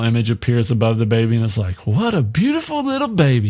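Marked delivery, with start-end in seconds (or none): 1.9-3.5 put-on much higher, cooing old woman; high, sing-song granny coo. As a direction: admire the baby like a gentle grandmother. Mother Abigail's image speaks.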